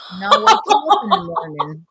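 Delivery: high and loud, breaking into laughter; can barely finish the line for laughing; laughing through it. Retold or imitated. Retelling the teacher's creepy offer, cracking up.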